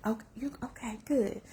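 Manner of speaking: breathless whispered relief; inner whisper. Retold or imitated. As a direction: whisper it to yourself, amazed. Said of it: "that I was like".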